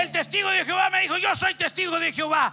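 loud, high preaching; loud triumphant pulpit voice; preaching climax. Real, not acted. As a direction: build it up loud.

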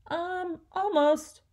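put-on a boy stalling, calling back up high. A narrator's reading. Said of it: "Marco called back".

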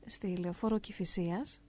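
slow, breathy whisper of the place name; hushed slow aside. Real, not acted. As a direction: drop to a whisper on "στη λεωφόρο Κηφισίας". Aside within the story.

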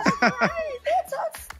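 excited. Real, not acted. Gender reveal celebration.

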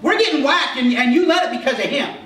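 high, loud outraged outburst, half laughing; bursting out to denounce the fool, pitch way up; shouting at the king. Retold or imitated. Esther as imagined by the preacher, blurting to the king in a loud high outburst. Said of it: "I would have gone in and said".

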